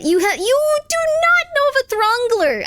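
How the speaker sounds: high squeaky outraged cry; mock outrage. Streamer yelling at chat who claim the Throngler, high mock outrage. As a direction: yell it in comic disbelief.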